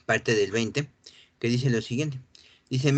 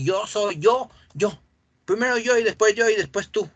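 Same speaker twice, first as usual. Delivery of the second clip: mocking selfish voice; 8 semitones higher, 4 dB louder than usual.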